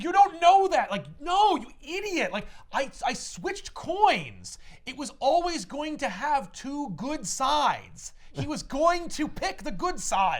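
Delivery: high, loud, exasperated argument; angry protest. Retold or imitated. Voicing his quarrel with Selina over switched coins, angry shouting. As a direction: argue back in an acted outburst, pitch soaring.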